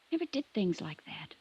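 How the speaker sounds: breathy near-whisper, barely voiced; hushed confession. Daughter whispering to the investigator about her gentle Tom. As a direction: let it slip out in a whisper.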